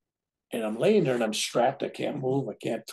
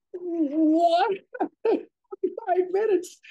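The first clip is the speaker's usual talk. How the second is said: shocked disbelief; 4 dB louder, much slower than usual. Retold or imitated. Hears surgery will last longer.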